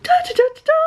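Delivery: imitating high girl voice. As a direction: squeak the syllables way up high. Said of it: "when she does the girl's voice".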